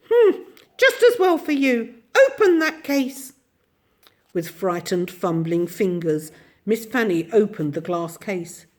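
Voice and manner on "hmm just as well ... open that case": put-on high, bright old little woman voice; tiny ancient shopkeeper. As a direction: chatter away as a tiny ancient lady. Mrs Corey orders Fanny.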